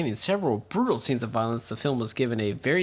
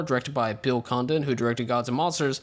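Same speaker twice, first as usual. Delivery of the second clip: normal talking voice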